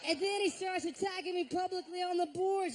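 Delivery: ordinary delivery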